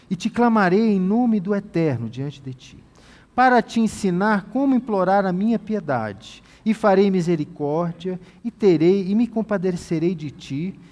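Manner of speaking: lifted, solemn Bible reading; scripture reading. Acted. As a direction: proclaim it like scripture. Reads God's words aloud.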